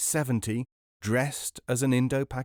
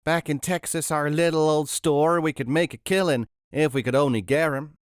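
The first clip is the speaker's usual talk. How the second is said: loud, high Texan twang; Texan woman bragging about her store; 7 semitones higher, 6 dB louder than usual. A narrator's reading. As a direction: boast it like a Texas shopkeeper. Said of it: "said the third".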